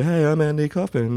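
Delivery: lightly voiced Kaufman, high with a laugh; a hint of Kaufman's giggly high voice; Andy Kaufman voice. Recalling Andy Kaufman's normal voice, lightly imitated. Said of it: "I remember I learned that when I was watching Andy Kaufman".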